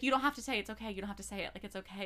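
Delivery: soft, hushed reassurance; softly imitating a tender hero; gentle comforting. Retelling tender comfort scene.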